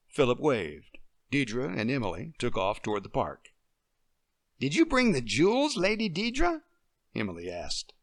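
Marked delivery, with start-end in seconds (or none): 4.6-6.6 put-on higher, regal little girl queen; a little girl playing queen; child playing queen. Emily playing the queen for Deirdre.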